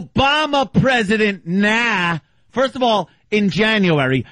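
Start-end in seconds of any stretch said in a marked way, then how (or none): 0.0-2.2 high, sneering taunt imitation; mocking heckler. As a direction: mimic the heckler's jeer again for a friend. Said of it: "Like that?".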